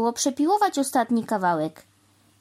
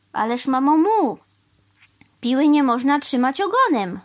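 higher, louder objection; exclaimed protest; 6 semitones higher, 6 dB louder than usual. A narrator's reading. Crow objecting to sawing by tail, exclaimed protest. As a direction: call out a shocked correction. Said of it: "zawołał pan Wrona".

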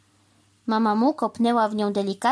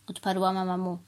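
usual speaking voice

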